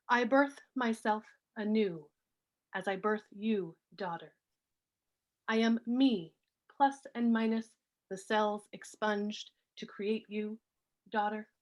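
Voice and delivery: measured poem recital with pauses between phrases; poetry reading. Acted. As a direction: read each line deliberately, letting phrases fall. Reads own poem aloud.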